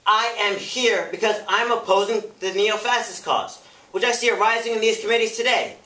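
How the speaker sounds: high, loud, impassioned counter-accusation; fiery defiant witness. Acted. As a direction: fire back, building in pitch and volume. [name] fires back at committee.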